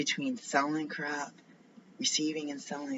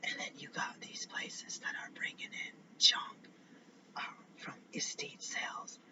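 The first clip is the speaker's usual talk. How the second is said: hushed, voiceless whisper; confiding under the breath; 7 dB quieter than usual. Real, not acted. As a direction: whisper it so nobody overhears. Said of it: "Why am I whispering?".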